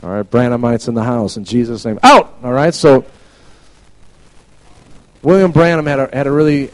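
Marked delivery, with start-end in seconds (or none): 2.0-2.4 sudden high, loud shout; shouted command. Pretend. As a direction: bark it out. Mock casting out Branhamites.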